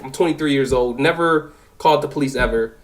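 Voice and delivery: ordinary delivery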